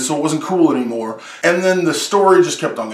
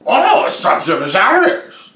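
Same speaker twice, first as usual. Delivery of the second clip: slow, booming show-title announcement; announcer voice; 5 semitones higher, 3 dB louder than usual. Acted.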